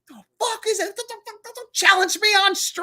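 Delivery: high-pitched sputtering outrage, stammering on don't; hammed-up sputter of protest; mock upset rant. Streamer imitating his old on-stream upset, mock rant. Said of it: "I used to get really upset".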